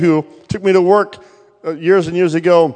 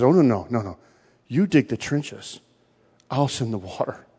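gentle God; 7 semitones lower, 9 dB quieter than usual. Retold or imitated. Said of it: "And God says".

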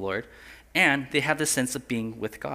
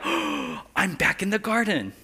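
mock wonder; 4 semitones higher than usual. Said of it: "you're supposed to feel like".

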